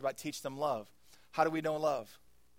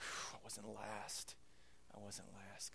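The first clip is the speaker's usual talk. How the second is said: relieved; 4 semitones lower, 12 dB quieter, slower than usual. Retold or imitated. Relieved kid picked for team.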